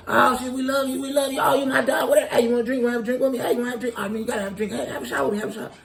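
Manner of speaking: high-pitched gushing fan offering drinks; starstruck fan. Retold or imitated. Club fan to the comedian after the show, gushing and excited. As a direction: gush like an overexcited fan pushing shots on you.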